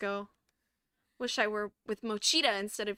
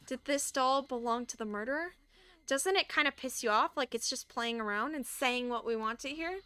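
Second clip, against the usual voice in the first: normal talking voice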